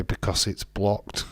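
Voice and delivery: usual speaking voice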